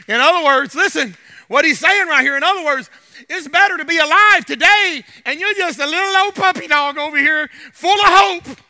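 loud, driving sermon delivery; fiery pulpit push; preaching at full force. Acted. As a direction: preach it hard to the room.

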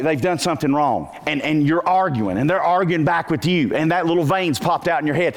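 ordinary talk

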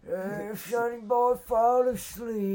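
slow, high, put-on old man. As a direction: croak it out like a sleepy grandpa. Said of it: "like a soothing old man voice".

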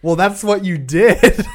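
high, loud, drawn-out retort through laughter; laughing protest. Real, not acted. Defending the butthole story to co-host, laughing protest. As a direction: protest loudly while cracking up.

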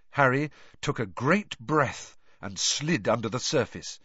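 plain reading voice

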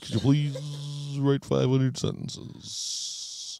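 slow, put-on prim schoolteacher; mock the strict old teacher slowly; assigning punishment lines in a lofty voice; stuffy teacher voice. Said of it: "I swear that's how he talked".

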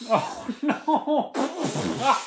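high, laughing "oh no". Real, not acted. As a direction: say it through laughter. Laughing at friend's struggle.

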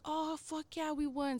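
celebrating guy, voiced high but held back; retold celebration. Teammate celebrating beer pong win.

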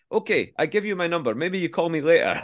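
high, broken-English dealer voice; persistent hustler. Retold or imitated. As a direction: coax him like a pushy tout. Dealer offers his number.

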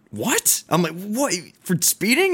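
high-pitched, disbelieving question; incredulous. Retold or imitated. Retells disbelief at being sent to court.

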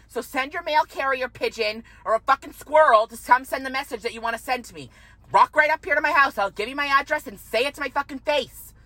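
heated rant. Real, not acted. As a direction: lay into the gossips with fury. Ranting at the gossips, high energy.

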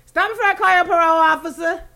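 high, loud mock movie mom; movie character quote. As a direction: fire it off like the film's scolding mom.

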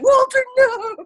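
high, loud anguished cry of the name; anguished shout. Retold or imitated. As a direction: wail the name as a friend walks out. Retelling a friend quitting his job.

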